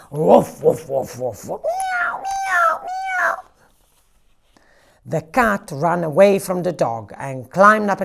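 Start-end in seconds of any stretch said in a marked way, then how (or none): 0.1-1.6 barked woofs fading down; dog barking. A narrator's reading. Storyteller barking as the dog chasing the cat. 1.6-3.3 very high, thin meows; cat meowing. A narrator's reading. The chased cat crying meow, very high.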